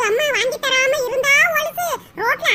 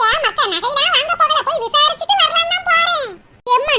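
normal talking voice

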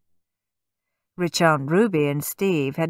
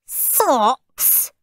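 mock scream; 7 semitones higher than usual. A narrator's reading. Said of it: "screamed Stuck-up Steve".

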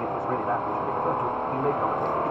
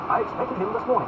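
haughty duke; 10 semitones higher, 4 dB louder than usual. Acted. Buckingham voiced high.

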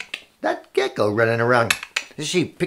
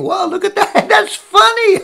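high, laughing exclamation; amused onlooker; 4 dB louder than usual. Acted. Bystanders watch the animal chase, high and laughing. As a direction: crow with laughter, pitched way up.

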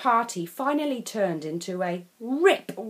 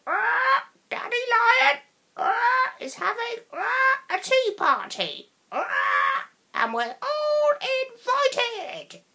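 high squawking parrot cries; 3 dB louder, slower than usual. A narrator's reading. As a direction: screech it like parrots overhead.

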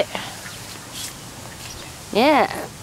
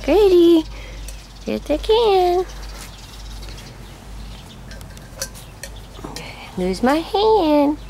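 high sing-song pet voice; teaching a parrot; 8 semitones higher than usual. Real, not acted. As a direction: coax the bird, high and sweet. Coaxing a parrot to talk.

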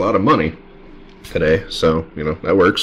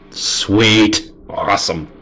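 usual speaking voice

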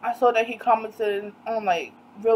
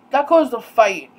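louder, emphatic delivery; emphatic statement; 6 dB louder than usual. Real, not acted. Telling viewers what his comments caused, emphatically. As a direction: state it forcefully, falling at the end. Says the line twice, second muted.

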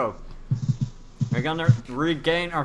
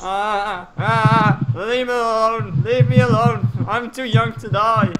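higher, louder hammed-up panicked plea; mock panic; 6 semitones higher, 4 dB louder than usual. Fleeing a mob in the game, hammed-up panicked pleading. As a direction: beg for your life in mock terror.

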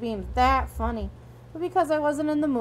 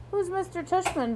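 higher, groggy question; 6 semitones higher, 3 dB quieter than usual. A narrator's reading. Via, just woken up, asking who he is, groggy. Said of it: "Via asked groggily".

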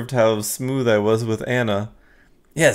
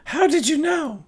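much higher, slow, amazed; woman client; slower than usual. Acted. Anna wonders how he knew her name, much higher voice. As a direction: ask it high and slowly, charmed.

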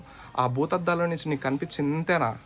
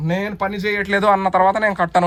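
higher, louder, indignant protest; loud refusal; 5 semitones higher, 6 dB louder than usual. Real, not acted. Man refusing to pack after the quarrel, loud indignant refusal. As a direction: refuse loudly and insistently.

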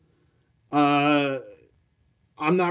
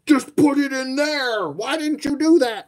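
mock angry viewers; 11 semitones higher, 4 dB louder than usual. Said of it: "they're like ... they get angry".

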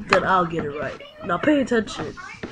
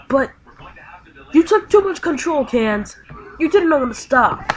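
higher, louder reproach; scolding; 5 semitones higher, 4 dB louder than usual. Acted. Kid blaming his partner for taking over, loud reproach. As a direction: tell him off.

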